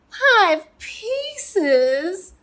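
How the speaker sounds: put-on high, drawn-out childish whine; whining. Little Pea told to eat five pieces, whining high. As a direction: whine it in disbelief, high and rising. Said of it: "he whined".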